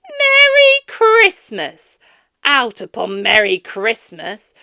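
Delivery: loud, high, bitter tirade; ranting Scrooge. A narrator's reading. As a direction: pile up the grievances furiously. Scrooge rants at nephew.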